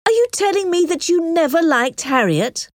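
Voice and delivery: high, disbelieving question; indignant, high-pitched disbelief; incredulous cry. A narrator's reading. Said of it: "cried Emma".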